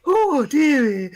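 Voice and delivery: high, loud, stretched-out nanny cry; Missus Doubtfire. Acted. Player repeating the impression for laughs, hammed-up nanny voice. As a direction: hoot it slowly like a hammed-up old dear. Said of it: "I can do Missus Doubtfire".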